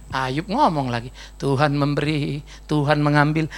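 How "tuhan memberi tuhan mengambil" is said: calm Job. Acted. Ayub tenang menjawab istrinya.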